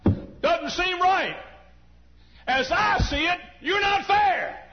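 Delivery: rising to a loud, high, slow cry at God; retold prayer complaint. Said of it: "and I said".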